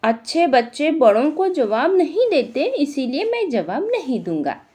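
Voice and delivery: put-on sweetly naive child answering the judge; a child innocently refusing to answer. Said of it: "Sunil फिर अपने भोलेपन से".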